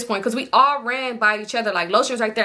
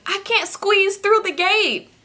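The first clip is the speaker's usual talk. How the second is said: high, slow mocking whine; mocking her friend stuck at the gate, a high slow whine; 10 semitones higher, slower than usual. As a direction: make fun of her helplessness.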